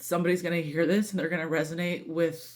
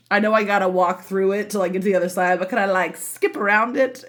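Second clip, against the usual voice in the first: whiny plea; 3 semitones higher, 7 dB louder than usual. Retold or imitated. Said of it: "I think it was something like".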